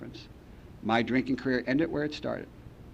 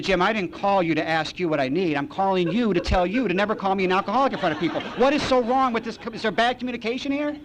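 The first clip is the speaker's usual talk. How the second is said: indignant rant; 7 semitones higher than usual. Retold or imitated. Drunk rants at Jim on the phone, high and exasperated. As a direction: climb into outrage on the last questions.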